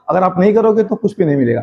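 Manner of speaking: ordinary delivery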